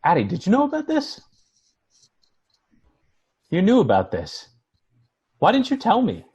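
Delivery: confronting a friend who kept a secret; stunned disbelief. Acted. Shocked by the inheritance.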